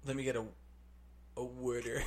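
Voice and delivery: "water" als "wooder" in Philly accent. Shown as a demonstration. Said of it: "How would you say bartender?".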